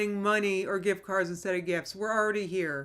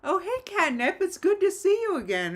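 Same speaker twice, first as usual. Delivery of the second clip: excited greeting; 10 semitones higher, 6 dB louder than usual. Real, not acted. Greeting a viewer who just joined.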